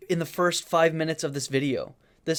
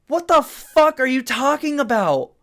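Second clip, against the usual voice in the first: normal talking voice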